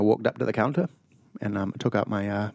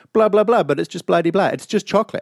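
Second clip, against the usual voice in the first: mocking the chef, higher and louder; dismissive chef; 6 semitones higher, 4 dB louder than usual. Imitating the chef's dismissive excuse, higher and louder. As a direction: brush it off like a careless chef. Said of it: "he was like".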